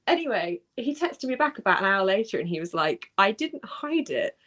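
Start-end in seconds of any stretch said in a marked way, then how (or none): 3.2-4.4 slow deadpan denial. Retold or imitated. Friend's flat denial.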